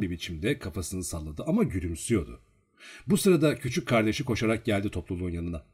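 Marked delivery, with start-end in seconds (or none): none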